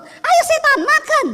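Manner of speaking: high, loud yell; loud rough Sulawesi call to eat; rough shout. Retold or imitated. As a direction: bellow it like a rough local. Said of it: "orang Sulawesi asli yang belum bertobat".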